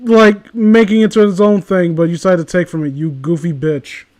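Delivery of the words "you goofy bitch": ordinary delivery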